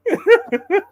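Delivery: laughing through painful coughs; stifled giggles. Real, not acted. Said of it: "I'm laughing and I'm coughing".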